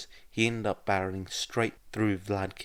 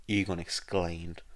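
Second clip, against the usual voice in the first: usual speaking voice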